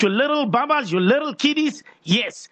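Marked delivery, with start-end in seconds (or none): none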